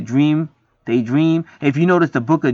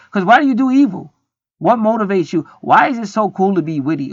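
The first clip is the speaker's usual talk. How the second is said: higher, louder indignant questioning; indignant rant; 6 semitones higher, 4 dB louder than usual. Real, not acted. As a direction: call them out, fed up. Ranting at people who do evil.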